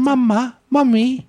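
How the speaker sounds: put-on high childlike Boston accent. Retelling calling his mother as a child, Boston accent. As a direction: say it like a little Boston kid. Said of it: "you're doing a Boston accent?".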